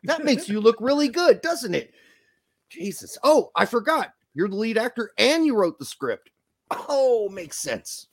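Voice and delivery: high-pitched mock realization; exaggerated sarcastic praise. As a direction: feign sudden understanding, dripping with sarcasm. Mocking actor who wrote script.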